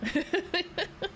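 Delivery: high giggle, rising. Real, not acted. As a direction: giggle at the question. Laughs after question.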